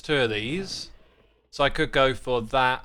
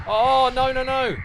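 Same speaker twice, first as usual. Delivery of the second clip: panicked; 7 semitones higher, 3 dB louder than usual. Real, not acted. Panic at tricky climb.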